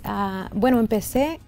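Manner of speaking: normal talking voice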